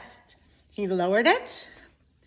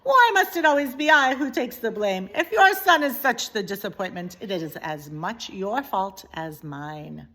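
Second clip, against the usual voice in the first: put-on French accent; 2 semitones higher, 5 dB louder than usual. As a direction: defend herself with French pique. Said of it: "said Antoinette".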